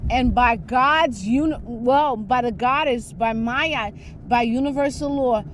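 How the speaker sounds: normal talking voice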